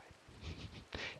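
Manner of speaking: hushed, voiceless chuckle; whispered laugh. Real, not acted. Player amused by own building story, whispered laugh.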